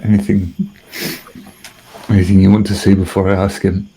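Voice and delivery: ordinary delivery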